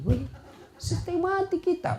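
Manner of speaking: high, soft comic gasp of dread; mock panic. Husband mocking his own fear of wife, high squeak. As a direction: play up the husband's fright.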